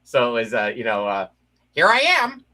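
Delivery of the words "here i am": much higher, louder comic secret agent voice; Get Smart voice. Retold or imitated. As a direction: play it like a goofy spy announcing himself. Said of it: "turned into Get Smart".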